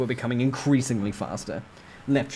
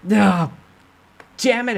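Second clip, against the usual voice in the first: loud frustrated curse; frustrated outburst; 11 semitones higher, 10 dB louder, slower than usual. Acted. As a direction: curse the missing skill. Frustrated cry in fight.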